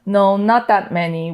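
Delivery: usual speaking voice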